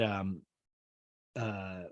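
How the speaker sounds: usual speaking voice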